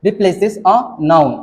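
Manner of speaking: replaces a noun, each word drawn out slowly; slow dictation. Shown as a demonstration. Teacher dictates the pronoun definition slowly to students. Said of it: "इस तरीके से बना सकते हैं".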